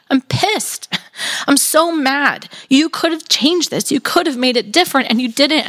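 raw anger. Acted. Martha confronts Jesus over her brother's death, raw and angry. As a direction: let the anger spill out high. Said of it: "with such raw honesty and say".

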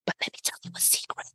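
hushed, fast whisper. Shown as a demonstration. Speaker demonstrating how a dropped voice hooks the audience, whispered. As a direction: drop to a secret whisper. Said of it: "I just crouched down and dropped my voice".

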